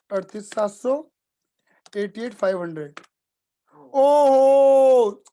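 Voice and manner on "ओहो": long, loud, drawn-out exclamation of relief; surprised ohoo. Real, not acted. Teacher reacting to the balance sheet matching, loud drawn-out relief. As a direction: let out a big stretched ohoo as it all adds up.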